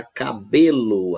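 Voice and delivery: "cabelo" slow, with a loud, long "be"; stress on BE. Shown as a demonstration. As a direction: punch and stretch "be" in "cabelo". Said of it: "a sílaba tônica é o B". ("cabelo" devagar, com força no "be")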